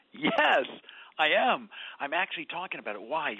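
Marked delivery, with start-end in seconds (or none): 0.1-1.7 emphatic yes. Real, not acted. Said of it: "Are you really talking about two chairs".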